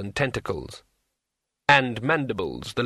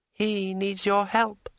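higher, slow, silky tree spirit; smooth dryad voice; 9 semitones higher, slower than usual. A narrator's reading. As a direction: glide through it smoothly. Dryad speaks.

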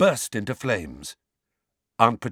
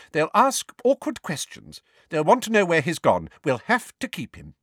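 brisk; 8 semitones higher, 4 dB louder than usual. Acted. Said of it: "her usual brisk, snappish manner".

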